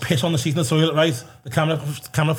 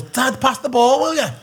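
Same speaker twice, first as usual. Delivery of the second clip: lightly voiced son, higher pitch; imitated child calling; 8 semitones higher than usual. Son to dad during football, lightly imitated calling out. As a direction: call out like a kid wanting the ball.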